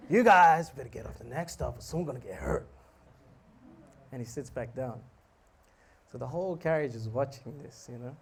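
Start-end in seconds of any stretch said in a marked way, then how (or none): 0.1-2.7 loud outburst sinking into slurred threat; angry drunk. Retold or imitated. Drunk man confronts the singer, angry threat. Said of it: "this drunken guy goes".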